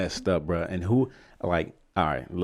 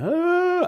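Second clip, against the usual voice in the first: very high, louder mock wail; imitated wail; 5 dB louder than usual. Storyteller mimicking grieving travelers at the airport, high mock wail. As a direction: wail like someone bawling, as an imitation. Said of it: "wailing. Like".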